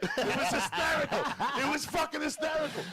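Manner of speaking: high, loud laughing exclamation; laughing shout. Real, not acted. Recalling the kitchen talks, laughing shout. Cracking up at the memory.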